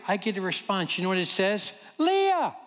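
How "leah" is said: gleeful reveal. Retold or imitated. Reading friend's text reply.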